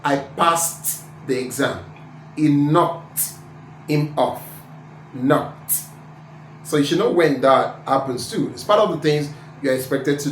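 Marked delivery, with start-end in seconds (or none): 0.0-5.7 PASSED and KNOCKED end in a released T. Shown as a demonstration. Teacher giving examples, PASSED and KNOCKED with final T. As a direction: finish PASSED and KNOCKED with a crisp t. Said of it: "when E D will become ta".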